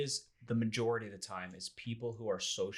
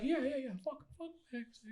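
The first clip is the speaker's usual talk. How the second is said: much higher, hesitant mock voice forcing out the words; a man who won't quite say the slur, higher and reluctant; half-hearted slur; 3 dB quieter than usual. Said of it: "He doesn't wanna say it all the way".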